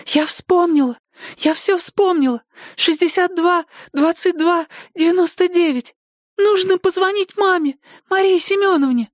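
high breathless excitement; excited girl voice. Acted. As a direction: blurt it out, thrilled.

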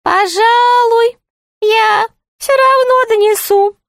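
very high, syrupy jester voice; sugary, high-pitched taunt; sweet-voiced jester. A narrator's reading. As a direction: threaten sweetly, drawing out the start. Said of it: "сказал шут сладким голосом".

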